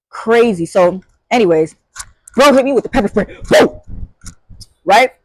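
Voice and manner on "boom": a sharp high boom sound; imitated boom. Shown as a demonstration. Mimicking the spray hitting, a high boom. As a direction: blurt a quick boom noise. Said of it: "It wasn't like a boom".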